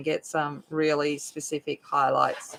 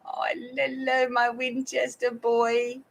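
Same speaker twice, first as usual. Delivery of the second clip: pet talk; 6 semitones higher, slower than usual. Real, not acted. Greeting her cat.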